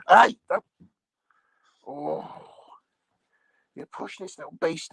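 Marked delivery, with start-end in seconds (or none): 0.1-0.6 sudden loud yell of hey; sharp shout. Real, not acted. Man shouting at the dog jumping on him, sudden loud yell. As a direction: bark a warning at the dog.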